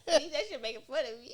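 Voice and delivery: high, loud, laughing protest. Real, not acted. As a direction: protest through real laughter. Teased, talking while laughing.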